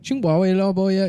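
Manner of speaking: a woman speaking gibberish Chinese, lightly played; mock Chinese. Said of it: "starts speaking to him in Chinese".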